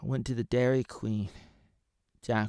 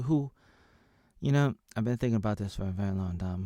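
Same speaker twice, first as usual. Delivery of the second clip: normal talking voice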